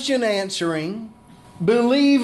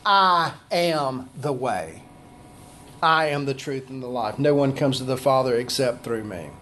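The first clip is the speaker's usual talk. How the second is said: solemn declaration; 9 semitones lower, 3 dB quieter than usual. Acted. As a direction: let it sink in like a final truth. Jesus' answer repeated with weight.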